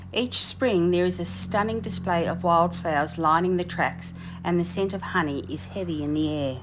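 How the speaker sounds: lyrical descriptive read; voiceover narration. Acted. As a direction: paint the scene like a travel show.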